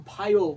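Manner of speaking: ভায়ো said loud and clear as two syllables; teacher stressing ভায়ো loudly; clear demo. Shown as a demonstration. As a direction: call out ভায়ো firmly for the class. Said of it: "তাহলে ভিআইও".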